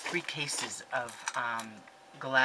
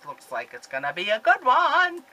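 시청자에게 들뜬 장난스러운 말투; playful excitement; 7 semitones higher, 10 dB louder than usual. Real, not acted. Teasing viewers to take notes.

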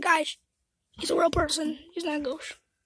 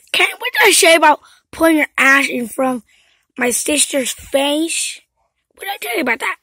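angry scolding; 2 semitones lower, 11 dB louder than usual. Pretend. Scolding Ken doll.

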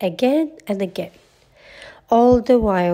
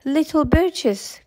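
high, slow cry without much force; calling out; 7 semitones higher, slower than usual. A narrator's reading. Baloo calls across the river to Mowgli, crying out. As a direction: draw it out as a far-off call. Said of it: "cried Baloo".

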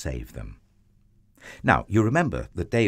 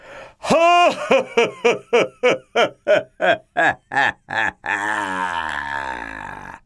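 terrible giant laugh; 4 dB louder than usual. A narrator's reading. Giant laughs at the small boy, terrible long laugh. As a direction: laugh in big separate bursts, then let it sink. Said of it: "laughed an even terribler laugh".

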